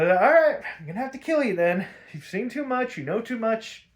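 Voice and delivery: high-pitched, hammed-up resignation; loud start, then rattle off the threat up high; mock villain threat. Ra's to Batman after being refused, mock-menacing.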